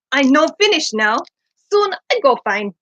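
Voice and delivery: chirpy foreign bird with halting grammar; a hint of Kihar's odd accent. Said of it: "your Kihar impression".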